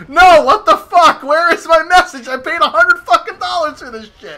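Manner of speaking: loud, high ranting yell; indignant shout, stretching the opening words; exasperated outburst. Retold or imitated. Retelling his outburst at missing message.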